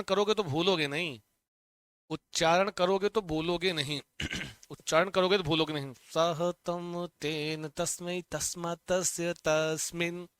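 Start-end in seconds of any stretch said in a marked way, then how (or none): 5.9-10.3 the whole form chanted slowly, a touch softer; slow recitation. Shown as a demonstration. Teacher showing how to read the full form, slowly. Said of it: "ऐसे पढ़ लेना है".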